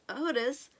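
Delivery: plain reading voice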